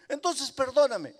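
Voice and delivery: put-on high, urgent little boy's plea; child's voice rising in desperation; pleading child. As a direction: beg your mother not to punish you. Boy pleads with strict mother.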